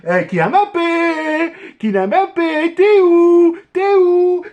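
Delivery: high, teasing call-out; mocking taunt. Real, not acted. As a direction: jeer at him playfully. Taunting absent former player.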